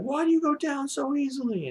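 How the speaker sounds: very high-pitched imitated taunt; mock outburst. Shinichi to the boy he just hit, hammed-up high outburst. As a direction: play the worked-up fighter in a squeaky high voice. Acted quote in retold fight.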